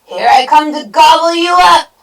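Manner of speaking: high, very loud troll bellow; all-out storybook villain yell. A narrator's reading. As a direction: bellow it as you charge.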